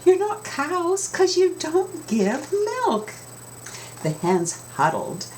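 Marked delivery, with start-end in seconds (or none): 0.1-3.1 high, slow mooing voice; cow voice. Acted. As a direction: drawl it like a smug cow. Cows answer hens.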